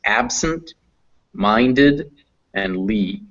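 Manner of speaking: "absent", "minded", "Lee" as separate chunks with pauses; teacher sounding out "absent", "minded", "Lee" in turn; broken into chunks. Shown as a demonstration. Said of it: "let's try pronouncing in some chunks".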